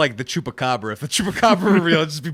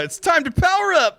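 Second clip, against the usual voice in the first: hero catchphrase; 12 semitones higher than usual. Retold or imitated.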